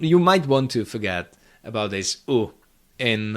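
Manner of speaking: ordinary talk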